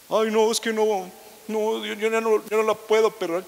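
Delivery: unforgiving spouse refusing to forgive, mock whine; whiny refusal. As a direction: drag out a sulky complaint.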